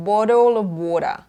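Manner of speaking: BOTTLE OF WATER with D for each T. Shown as a demonstration. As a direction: flap both Ts into Ds in BOTTLE OF WATER. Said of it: "ไม่ได้เปลี่ยนเป็น". (bottle of water ออกเสียง T เป็นดอเด็ก)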